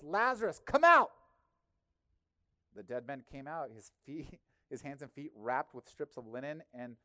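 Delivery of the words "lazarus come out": full shout, pitch rising high; loud call. Acted. Jesus shouting to dead Lazarus in the tomb, full-voiced call. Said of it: "called in a loud voice".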